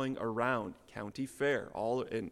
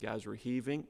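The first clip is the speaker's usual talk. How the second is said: ordinary talk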